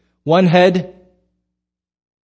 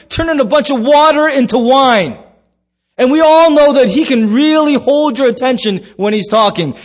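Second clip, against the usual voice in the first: disciples gasping in disbelief; amazed disciples; 10 semitones higher, 6 dB louder than usual. Acted. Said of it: "That's the Pastor Dave version".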